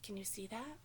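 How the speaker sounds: ordinary talk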